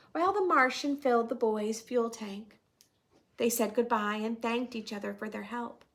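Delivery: storytelling voice. A narrator's reading. Reading picture book to class.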